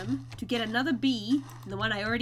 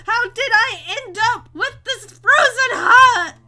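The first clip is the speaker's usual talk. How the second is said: mock anguish; 7 semitones higher, 7 dB louder, much slower than usual. Hammed-up lament over the bees.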